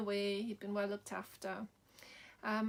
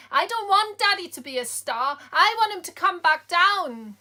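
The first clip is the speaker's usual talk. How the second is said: put-on much higher and louder, a little boy's protest; child shouting; 8 semitones higher, 16 dB louder than usual. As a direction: yell it like a heartbroken toddler. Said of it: "And he just shouted".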